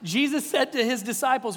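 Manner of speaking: normal talking voice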